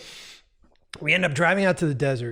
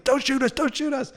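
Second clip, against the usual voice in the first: mock screaming; 10 semitones higher than usual. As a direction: imitate a frantic scream without full volume. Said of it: "like, screaming".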